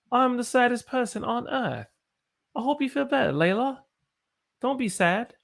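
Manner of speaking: put-on high-pitched British accent; playful British accent in a high voice. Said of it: "in my British accent".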